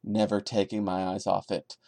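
ordinary talk, nothing marked

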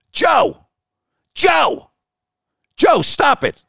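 high-pitched, urgent calls to interrupt; calling out. Retold or imitated. Host on the phone calling out to stop the owner's rant, high urgent calls.

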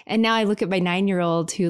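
ordinary talk

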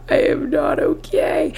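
mock sob. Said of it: "I was sobbing".

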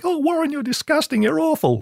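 high disgusted squeal; mock screaming producer. Producer scolding Warren, high mock disgusted screech. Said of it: "the producer was screaming".

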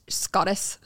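"Scottish" ending in a plain s sound; mispronounced Scottish. Real, not acted. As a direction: fumble the end of "Scottish", losing the sh. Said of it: "You don't say it right".

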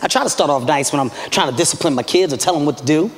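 ordinary talk

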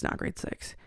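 breathy whisper, almost to herself; whispered correction. Real, not acted. Correcting her own age to the listener, whispered. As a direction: correct the number under your breath.

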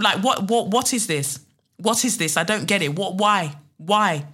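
ordinary delivery

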